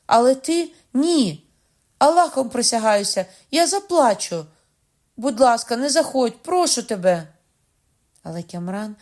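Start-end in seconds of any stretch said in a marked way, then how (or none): 0.0-7.3 high, loud plea near tears; desperate pleading. Acted. Bride begs Kamran desperately not to come in, loud. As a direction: beg him frantically not to come in.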